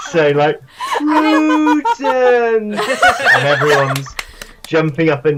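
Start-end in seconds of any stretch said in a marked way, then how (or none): 1.0-4.1 drawn-out slow-motion shout. Acted. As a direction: stretch it like a slow-mo replay. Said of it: "laugh in slow motion".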